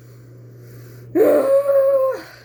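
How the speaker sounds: long high loud groaning sigh; loud exasperated sigh. Real, not acted. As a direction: let it all out in one big sigh. Emotional rant about parents.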